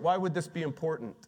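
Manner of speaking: usual speaking voice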